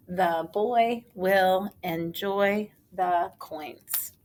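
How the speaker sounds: put-on The boy will enjoy the coins read slowly word by word; slow readout. Shown as a demonstration. Teacher reading back the answer sentence slowly. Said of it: "here's the sentence".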